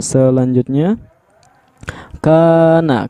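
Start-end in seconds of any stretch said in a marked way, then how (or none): 2.2-3.1 "kana" with the first a held, higher; chanted long "kaa" in "kana"; two-count long vowel. Shown as a demonstration. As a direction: lengthen the first a of "kana", then drop. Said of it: "Bacaannya dibaca panjang dua harokat".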